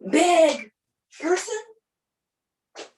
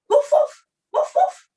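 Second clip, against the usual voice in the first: high, loud woof woofs; barking dog; 4 dB louder than usual. Acted. Comic teaching a kanji, barking high like a dog. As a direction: bark like an excited little dog.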